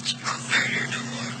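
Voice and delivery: breathy whisper, almost no voice. Real, not acted. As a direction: whisper the words to herself. Mother repeats line, then whispers.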